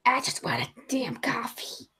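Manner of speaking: unvoiced whispered grumble. Real, not acted. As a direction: whisper it, longing for coffee. Grumbling for coffee.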